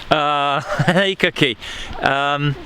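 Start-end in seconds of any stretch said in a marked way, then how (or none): none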